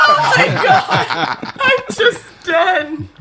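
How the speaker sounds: slow, high laughing. Real, not acted. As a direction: drag it out laughing. Laughing after failed accent.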